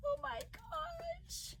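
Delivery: high, slow and hushed thrill; delighted soft squeal. Real, not acted. Admiring a beautiful card, soft delighted squeal. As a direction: squeal softly, stretching it out.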